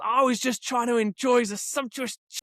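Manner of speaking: hammed-up protest to police; arrested man impression. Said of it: "and he goes".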